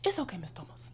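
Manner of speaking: put-on a soft whispered child's forgiveness; hushed imitation of a student; whispered kid reply. Kids' reply voiced by teacher.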